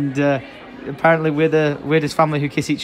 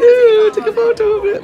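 much higher, louder, hammed-up cooing at the camera; high mock squeal; 11 dB louder than usual. Son mimics mum posing for the photo, high mock squeal. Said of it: "Mum's already like".

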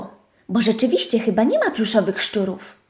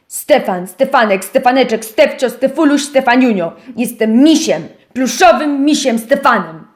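radosny miś; 3 dB louder than usual. Acted. Miś cieszy się imieniem.

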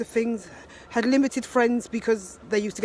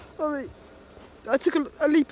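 tearful; 6 semitones higher than usual. Real, not acted. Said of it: "very emotional".

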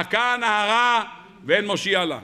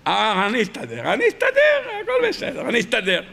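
acted coping doctor. Lone night doctor reassuring himself, acted squeaky leap. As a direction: play a doctor squeakily insisting he can cope.

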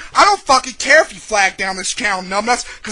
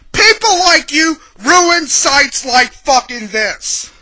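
raised, loud, high tirade; angry rant; 5 semitones higher, 5 dB louder than usual. Real, not acted. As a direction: lay into the troll, slowing to hammer the last phrase. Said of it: "this is gonna be a fucking angry".

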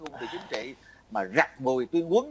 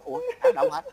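bewildered surprise; 4 dB louder than usual. Retold or imitated. Recalls his own bewildered reaction.

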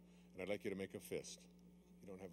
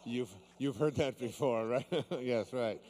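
laughing through the words; 4 semitones higher, 9 dB louder, slower than usual. Real, not acted. Teasing the volunteer about her name, laughing. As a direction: chuckle as you tease her.

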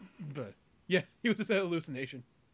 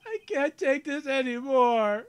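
louder, desperate give-up cry; anguished outcry; 5 semitones higher, 7 dB louder, slower than usual. Acted. Defeated lawyer cries out.

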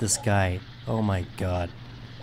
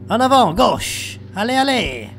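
shouted commands; 10 semitones higher, 7 dB louder than usual. Real, not acted. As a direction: urge the dog team on loudly. Shouting commands to game dogs.

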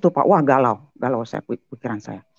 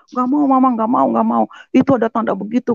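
put-on higher whiny child voice, lightly done; mild mock of a child's protest; imitating a scared child; 8 semitones higher than usual. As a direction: play the little child refusing.

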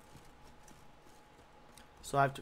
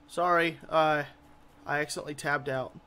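normal talking voice

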